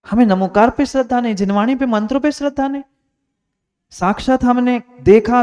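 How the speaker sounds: high, loud sermon delivery; impassioned preaching. Acted. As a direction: preach at full force, scolding the doubters. Preaches at full force. (ऊँचे स्वर में जोशीला, फटकारता प्रवचन)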